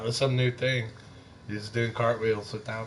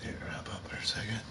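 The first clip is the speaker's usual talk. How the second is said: whisper; 2 semitones lower, 9 dB quieter, faster than usual. Real, not acted. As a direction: whisper it, hurried and gentle. Gently moving the child.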